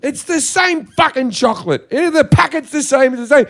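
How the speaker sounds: loud and fed up; exasperated outburst. Real, not acted. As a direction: insist it's identical, getting heated. Exasperated rant about identical chocolate.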